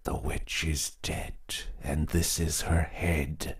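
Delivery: slow, hushed ritual whisper. A narrator's reading. Husband handing round the head, slow eerie whisper. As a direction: breathe the rhyme into the dark. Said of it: "whispered the husband".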